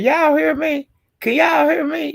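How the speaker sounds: high, anxious call into the phone. Retold or imitated. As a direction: imitate someone checking if they're heard. Meek calling out on the call.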